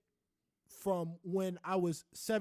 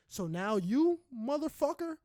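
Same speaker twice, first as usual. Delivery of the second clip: building from a firm talk to a high, loud shout; rising anger, hammed up at an imagined stranger; mock furious rant; 7 semitones higher, 3 dB louder than usual.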